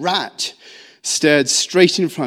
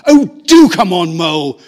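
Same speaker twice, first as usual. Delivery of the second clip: reproachful; 9 dB louder than usual. A narrator's reading. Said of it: "replied Ratty reproachfully".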